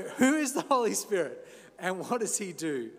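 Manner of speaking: mock Austrian action-hero accent; Arnold Schwarzenegger. Preacher jokingly asking the sermon's question, mock Austrian accent. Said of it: "that in Arnie's accent".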